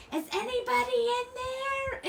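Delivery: put-on loud high-pitched kid's holler. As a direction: mimic a child calling into a closed building. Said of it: "hollering inside the Mister Freeze".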